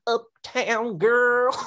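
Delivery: high, slow, stretched-out sung mockery; mock his drawn-out singing. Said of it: "he sings it with this inflection".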